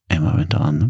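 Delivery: ordinary delivery, nothing marked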